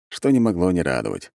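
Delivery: plain reading voice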